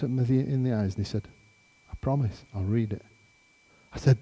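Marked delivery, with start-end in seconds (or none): 1.9-3.0 soft, hushed retold promise; quiet, earnest assurance; solemn promise. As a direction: drop right down for a sincere promise.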